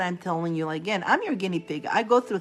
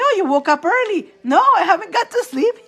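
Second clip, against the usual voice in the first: retold exchange; 12 semitones higher, 8 dB louder than usual.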